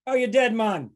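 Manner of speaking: mock Jamaican movie line; Jamaican accent. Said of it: "Speaking of Jamaican, uh, Cool Runnings".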